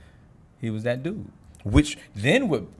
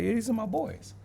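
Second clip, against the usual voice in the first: casual claim; 8 semitones higher, 7 dB quieter than usual. Retold or imitated. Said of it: "He might be like".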